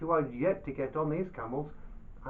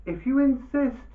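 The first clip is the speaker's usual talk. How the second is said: higher, louder mock-humble holy man; Dervish's reply; 10 semitones higher, 6 dB louder than usual. A narrator's reading. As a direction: play the sly modest Dervish. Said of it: "And the Dervish said".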